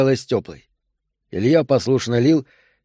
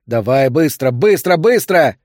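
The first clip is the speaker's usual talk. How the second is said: urgent order; 4 dB louder than usual. A narrator's reading. Doctor sends the husband for supplies, loud urgent command. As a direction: drive him to hurry.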